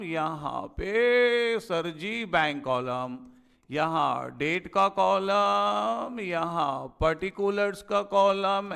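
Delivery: slow dictation. Shown as a demonstration. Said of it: "slow board dictation".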